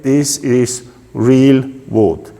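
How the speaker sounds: ordinary talk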